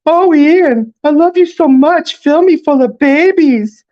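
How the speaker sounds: very high, loud swooning squeal; mock lovestruck falsetto. A narrator's reading. Best friend teasing Ian about his crush, mock lovestruck falsetto. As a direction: tease in a screechy lover's falsetto. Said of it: "his voice rising several octaves".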